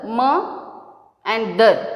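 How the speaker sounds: "ma" and "dar" as separate beats; split into syllables. Shown as a demonstration. Teacher showing the parts of mother, "ma and dar" split apart. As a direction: pause between "ma" and "dar". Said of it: "when we say mother".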